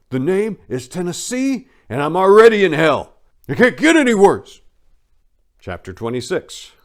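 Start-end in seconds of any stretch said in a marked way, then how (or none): 0.1-4.6 much higher, loud, bitter outburst; defiant shout. Retold or imitated. Jeff stands up to the people scolding him, shouting defiantly. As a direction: fling it back at them, fully defiant.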